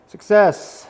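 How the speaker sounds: a bright, loud little win; excited cheer. Real, not acted. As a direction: call out the success with pride. Cut through successfully.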